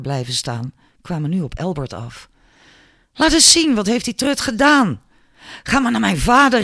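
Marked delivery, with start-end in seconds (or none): 3.1-5.0 high, loud, indignant question; alarmed outburst. A narrator's reading. Friends rush to wounded Albert, loud and indignant. 5.6-6.6 high, loud angry call. A narrator's reading. Julia sends wounded Albert off, calling out angrily. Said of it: "riep ze".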